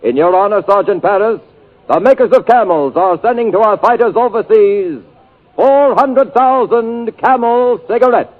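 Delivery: ordinary talk, nothing marked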